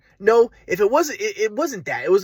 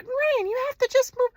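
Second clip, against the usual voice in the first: put-on girlfriend's voice. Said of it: "she's like".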